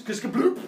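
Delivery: loud, high splashy kiskabloop; teacher voicing the splash of the gold bag; splash sound. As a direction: make the gold hitting the water.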